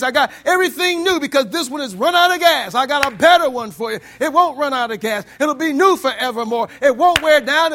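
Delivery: loud, high preaching of God's promise. Retold or imitated. Preacher voicing God to the congregation, loud and high. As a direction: proclaim it at full force, rising and ringing.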